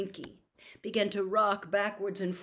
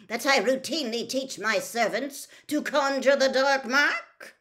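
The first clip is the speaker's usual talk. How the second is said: loud, raised, indignant sarcasm; cold anger; 7 semitones higher, 6 dB louder than usual. A narrator's reading. Senior official challenges the accusation, with cold fury. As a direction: build icily to an outraged question. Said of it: "cold anger in every syllable".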